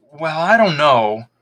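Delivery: slow, unsure reply; drawn-out doubtful answer; hesitant. A narrator's reading. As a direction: hold back, unsure of giving it up. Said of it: "I hesitated".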